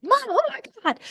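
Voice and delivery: high-pitched mock freak-out; kids losing it. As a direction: imitate kids melting down at mom. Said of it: "they're losing it".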